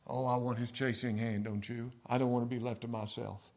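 ordinary talk

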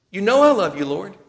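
Peter pleading. Retold or imitated. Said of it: "Peter's thrice denial".

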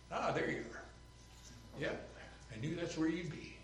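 soft, hushed voice of Jesus; a quiet, knowing aside from Jesus; softly spoken Jesus finding him in the tree; gentle Jesus. Acted. Jesus voiced calling Zacchaeus, hushed.

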